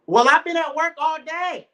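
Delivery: loud, high indignant retort; wife snapping back. Retold or imitated. Wife snaps back at husband, loud and indignant. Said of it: "And you like".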